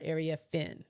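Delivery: Area Finn, a held f at the start of Finn; stress on Finn. Shown as a demonstration. Correcting how people say the player's name, Finn stressed. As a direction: land firmly on Finn. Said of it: "but it's the Finn part".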